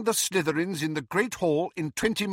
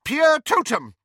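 high, loud incantation; spell-casting cry; 8 dB louder than usual. A narrator's reading. Professor raises her wand in the corridor, crying out. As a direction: call out the spell forcefully.

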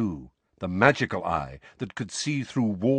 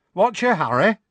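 high, loud, perky greeting; young witch; 11 semitones higher, 7 dB louder than usual. A narrator's reading. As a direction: call out a cheeky hello, pitched high.

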